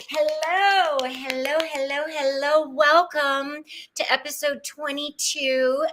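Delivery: high, bouncy hello hello; sing-song greeting. Real, not acted. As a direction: greet her warmly like a cheerful host.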